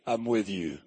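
a deep, easy reassurance from beside them; the Lord's voice. Retold or imitated. The Lord comforts the three in the furnace, low and soft. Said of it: "they heard somebody say".

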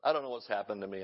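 ordinary talk, nothing marked

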